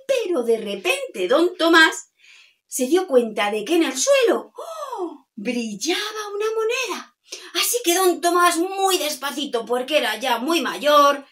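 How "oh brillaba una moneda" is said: hushed wonder. Acted. Descubre la moneda, asombro.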